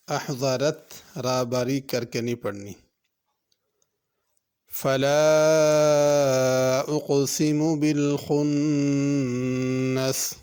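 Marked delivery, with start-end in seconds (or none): 4.7-10.3 slow, drawn-out chanted recitation; measured melodic tilawat; Quran recitation. Shown as a demonstration. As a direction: recite it like the teacher modelling tajweed. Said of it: "teacher recites verse to model tajweed".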